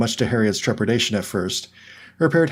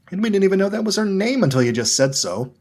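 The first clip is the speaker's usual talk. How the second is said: indignant retort; 10 semitones higher than usual. A narrator's reading.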